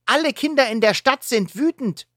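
high indignant complaint; complaining. Acted. Eulette complaining to the wolf kids, indignant and high. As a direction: complain on behalf of everyone. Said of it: "beschwert sich Eulette".

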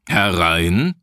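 gruff command to enter. A narrator's reading. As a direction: order it like an irritable captain. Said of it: "befahl Rodenstein".

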